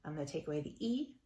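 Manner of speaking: ordinary talk, nothing marked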